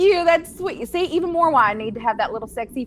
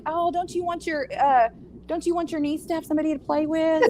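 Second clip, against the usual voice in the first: coaxing plea; 6 semitones higher than usual. Retold or imitated. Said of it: "I'm like".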